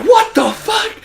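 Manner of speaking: high, loud incredulous cry; shocked what-the-fuck. Retold or imitated. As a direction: play the stunned kids on the sidewalk. Bystanders' shocked reaction.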